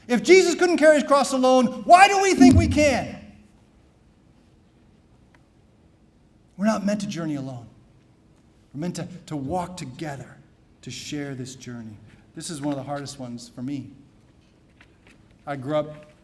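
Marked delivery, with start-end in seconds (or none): 0.1-3.2 loud, high rhetorical question; rousing pulpit challenge; emphatic preaching. Acted. 6.6-12.0 rising preacher's exhortation, building loud; fervent homily build; preaching. Acted. Preaching stations of the cross.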